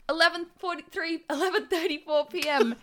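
high, sing-out call from afar; Persephone yelling. A narrator's reading. Persephone interrupting from the other room, high mock yell. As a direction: holler the time in a high voice. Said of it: "yelled Persephone".

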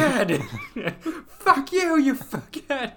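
high, giggling insults; laughing insult. Retold or imitated. Quoting sketch insults, laughing.

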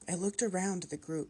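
plain reading voice

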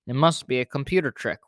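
ordinary delivery

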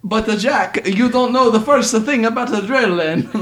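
high-pitched cartoon Italian accent; ridiculous Italian accent. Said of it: "with a ridiculous Italian accent".

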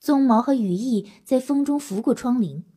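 usual speaking voice

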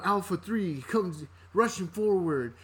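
slow, clipped squad-leader callout; military radio callout. Retold or imitated. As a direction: call it like a soldier on comms. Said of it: "the military terms".

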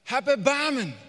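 high, loud cry for mercy; pleading cry. Acted. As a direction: let the plea burst out. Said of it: "von innen nach außen zu kehren und zu sagen". (lauter, flehender Ruf)

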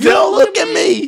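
high, drawn-out mock shout; hammed-up indignant squawk; sassy. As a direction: outdo the sassy impression, loud and slow. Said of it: "with a little sassy voice".

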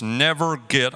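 normal talking voice

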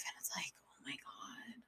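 overwhelmed whisper trailing off. Real, not acted. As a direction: drop to a breathless whisper.

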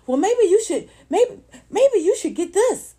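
high, loud commercial announcer parody; mock TV pitch, big and high; loud and proud. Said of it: "all loud and proud".

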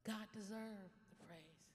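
ordinary talk, nothing marked

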